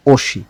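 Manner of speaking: "ওসি" with স carried onto the second syllable; linked pronunciation. Shown as a demonstration. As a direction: say "ওসি" with an s sound, not a t. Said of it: "উচ্চারণটা হয়ে যাবে".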